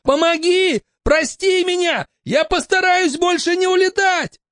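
high calling from afar; desperate cry. Acted. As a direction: plead as he drifts up. Boy floating away calls.

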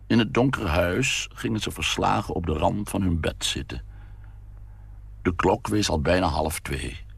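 ordinary delivery

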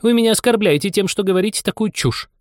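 high, offended mouse voice; indignant mouse. A narrator's reading. Mouse rebuking Anya for talking nonsense, indignant.

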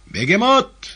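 high, loud summoning cry; shout. A narrator's reading. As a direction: yell for the cat. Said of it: "закричал он коту".

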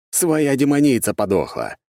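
usual speaking voice

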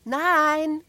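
put-on high, loud child's cry; little girl shouting. A narrator's reading. Little Ida answers her brother from the flagpole, shouting. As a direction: call it down in a piping, loud little voice. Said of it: "schrie die kleine Ida".

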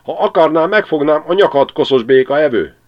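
high, loud, menacing hawk threat; fenyegető karvaly. A narrator's reading. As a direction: threaten to seize the stork's neck. (a karvaly dühösen fenyeget, magas, hangos hangon)

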